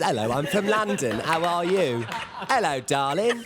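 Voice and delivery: slow, hammed-up London accent; mock Cockney, drawn out and singsong; Mary Poppins London accent. Actor teasing the fake English accent, mock Mary Poppins London. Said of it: "like, Mary Poppins".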